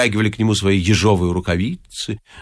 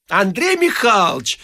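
higher-pitched cry from another room; woman calling out; 8 semitones higher than usual. A narrator's reading. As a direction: call out as an irritated wife. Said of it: "закричал женский голос из комнаты". (женский крик из комнаты)

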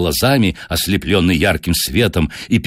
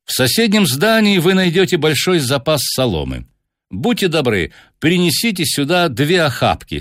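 higher-pitched, courteous detective; Holmes giving orders; 8 semitones higher than usual. Acted. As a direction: voice the detective politely directing, pitched up. Narrator voices Holmes's orders.

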